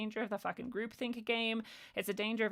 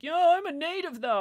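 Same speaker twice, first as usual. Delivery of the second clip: higher, louder smug imitation; mocking quote; 8 semitones higher, 8 dB louder than usual. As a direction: parody their smug claim. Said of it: "people were like".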